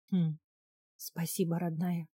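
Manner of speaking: understated tearful thanks; tearful murmur. A narrator's reading. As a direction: murmur gratitude through tears. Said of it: "сквозь слёзы пробормотала".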